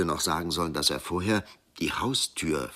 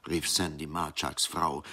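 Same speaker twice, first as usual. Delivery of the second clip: usual speaking voice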